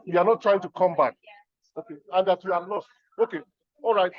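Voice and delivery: normal talking voice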